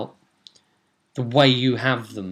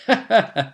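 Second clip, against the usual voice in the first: short high malign laugh; high, gloating little laugh; gleeful chuckle; 10 semitones higher, 4 dB louder than usual. Acted. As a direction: chuckle with evil glee. Gleeful laugh at scared players.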